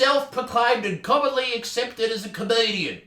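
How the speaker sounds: reading as the whiny cyclist; prissy forum poster voice; soy boy voice. Acted. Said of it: "So the cyclist voice will be".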